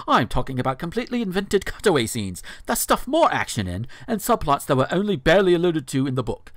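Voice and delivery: dramatic reading in a put-on non-American accent; theatrical recital with an affected foreign accent. Host dramatically reading a blog post, put-on non-American accent. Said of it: "Why are you doing this accent".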